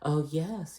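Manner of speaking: soft, high fake-saint voice; hammed-up innocence, hushed and high; mock innocent. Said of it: "all innocent and shit".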